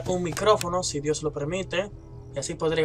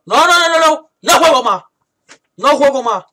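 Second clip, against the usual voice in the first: loud high panicked noes; terrified, giving up the game; panicking gamer; 8 semitones higher, 12 dB louder than usual. Real, not acted. Pánico al morir, abandona. (jugador en pánico, gritando que no)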